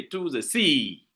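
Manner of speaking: slow, with "sea" held long; stress on SEA. Shown as a demonstration. Teacher showing which word is accented, stress on "sea". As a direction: pass lightly over "to the", insist on "sea". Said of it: "you insist on sea".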